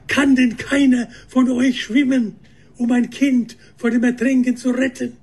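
high, urgent appeal; a mother desperately begging bystanders; hysterical pleading. A narrator's reading. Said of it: "hysterisch und mit den Händen gestikulierend".

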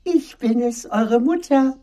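very high, coaxing fake-mother falsetto; wolf's disguised soft voice. A narrator's reading. Wolf claims to be mother, high chalk-softened voice. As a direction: pose as gentle mother in a high voice. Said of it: "rief der Wolf mit seiner neuen Stimme".

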